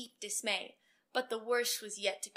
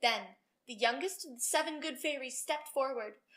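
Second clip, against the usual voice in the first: usual speaking voice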